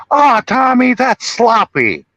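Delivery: high, loud mock villain drawl; hammed-up high villain voice; movie villain. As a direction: scold Tommy like a gleeful killer. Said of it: "And he was like".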